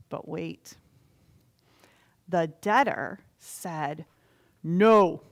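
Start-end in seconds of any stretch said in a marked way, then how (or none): 4.6-5.2 flat refusal. Acted. Debtor refuses.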